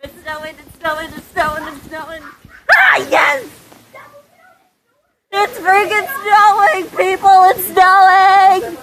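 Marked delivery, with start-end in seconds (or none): 2.7-3.5 high, jubilant yell; excited shout. Real, not acted. Kid celebrating the snowfall, excited. 5.3-8.8 loud, high announcement to everyone; excited shouting. Real, not acted. Kid announcing snow to viewers, excited shout.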